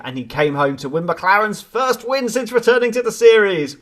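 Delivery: ordinary talk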